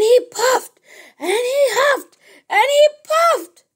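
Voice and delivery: high and loud, straining harder; big straining build. A narrator's reading. Wolf blowing at the brick house, straining, high and loud. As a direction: push it up like the wolf blowing with all his might.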